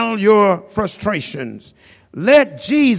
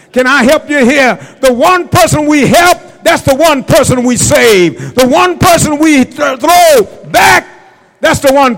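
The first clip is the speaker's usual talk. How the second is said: loud, higher preacher's cry on "help" and "person"; preaching shout; 5 semitones higher, 7 dB louder than usual. Acted. Preacher driving home the starfish lesson, loud shout. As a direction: shout it to the back pews. Climax about saving one person.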